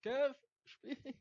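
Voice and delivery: high, separate urgent calls; urgent calling out. Real, not acted. Player demanding a pass from Kev, urgent calling out.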